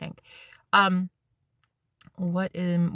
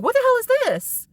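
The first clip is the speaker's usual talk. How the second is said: high, loud disbelief; squealing what-the-hell; shocked; 5 dB louder than usual. Retold or imitated. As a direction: blurt it out stunned. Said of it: "And I'm like".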